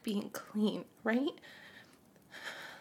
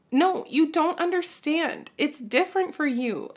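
louder, higher, pleading protest; upset outburst; 5 semitones higher, 9 dB louder than usual. Acted. Mother begging son not to leave, raised voice. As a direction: push back hard, voice rising. Arguing with son about going out.

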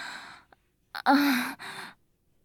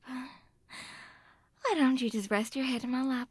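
ordinary talk, nothing marked

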